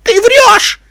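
very high, loud shriek; frantic, piercing mare's cry; hysterical scream. A narrator's reading. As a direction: scream the accusation at the top of your voice. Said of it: "истерично закричала какая-то кобылка".

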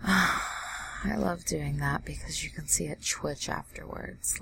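ordinary talk

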